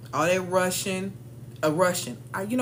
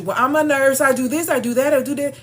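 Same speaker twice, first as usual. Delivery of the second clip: louder, higher, hurried self-selling; mock overeager rush; 6 semitones higher, 8 dB louder than usual. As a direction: gush about yourself to please the interviewer. Mocking nervous interviewee.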